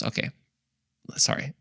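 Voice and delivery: ordinary talk, nothing marked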